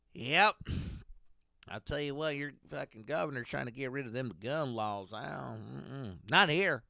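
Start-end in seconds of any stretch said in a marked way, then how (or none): none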